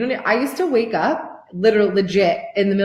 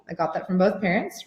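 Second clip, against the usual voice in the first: ordinary delivery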